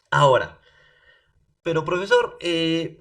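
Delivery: normal talking voice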